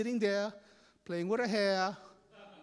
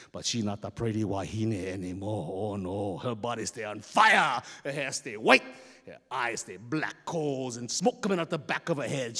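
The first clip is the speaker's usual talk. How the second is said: ominous narration; 9 semitones lower than usual. A narrator's reading. Storyteller revealing the girl as fiery goddess, ominous low voice. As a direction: build dread in a deep register.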